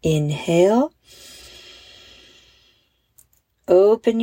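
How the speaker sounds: very slow, drawn-out breathing cues; slow-motion guided breathing. Shown as a demonstration. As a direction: stretch each breathing cue with long pauses. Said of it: "but even more slowly".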